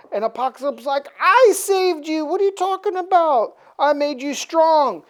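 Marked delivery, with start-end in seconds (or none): 1.2-5.0 high, stretched protest; hammed-up offended retort; mock indignation. Apocalypse insisting he saved Archangel, mock-indignant.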